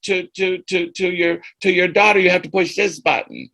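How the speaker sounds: high, lightly voiced first grader explaining patiently; little kid voice. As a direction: play the eager child helper. First grader teaching a senior.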